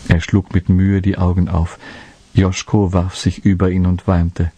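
usual speaking voice

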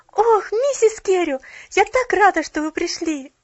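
high, loud, delighted greeting; joyful exclamation. A narrator's reading. Pollyanna glad that Mrs. Carew came, high and joyful. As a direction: cry out gladly like a child. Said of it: "с радостным возгласом".

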